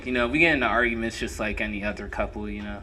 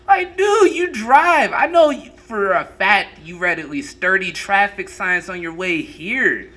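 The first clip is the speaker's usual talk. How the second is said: exasperated; 7 semitones higher, 4 dB louder than usual. Retold or imitated. Scolding the classmate.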